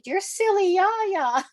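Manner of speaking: put-on high, slow child voice naming her; an excited kid in Walmart recognising her; little kid voice. Child in Walmart naming the author, high and slow. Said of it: "And they said".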